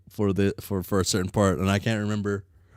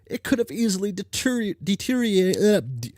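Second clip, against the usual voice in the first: put-on bright, higher girl character read; troll girl character voice; 11 semitones higher than usual. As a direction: voice the troll with cocky energy. Reads game character lines.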